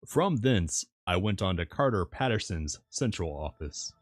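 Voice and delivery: usual speaking voice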